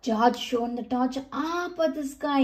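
plain reading voice

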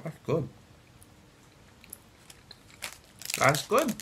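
speaking between bites; talking while chewing. Real, not acted. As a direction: mouth partly full of chocolate. Seen 1: Man bites chocolate at start, then chews while saying "that's good.

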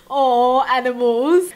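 usual speaking voice

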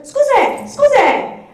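high, loud Scusi! Scusi! called over the counter; calling out Scusi. Retold or imitated. To shopkeepers ignoring tourists, a loud high call. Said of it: "And I went".

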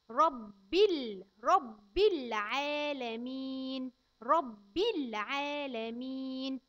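رب العالمين slowly with the رب punched loud; slow emphatic. Shown as a demonstration. Teacher stressing rabb for a child. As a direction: hit رب hard, then draw out العالمين. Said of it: "يبقى نقول ايه".

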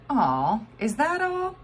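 high, let-down voice of a bystander; a man unimpressed by the spit; disappointed stranger. A narrator's reading. Stranger at the geyser, let down and whiny.

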